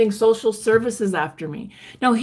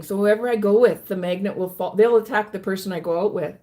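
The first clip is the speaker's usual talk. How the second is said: normal talking voice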